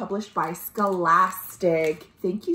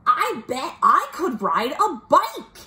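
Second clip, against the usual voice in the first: lightly voiced eager duck, higher pitched; a slightly raised storybook duck voice; Duck's thought; 5 semitones higher than usual. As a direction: lilt it up like a little duck with an idea. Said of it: "he thought".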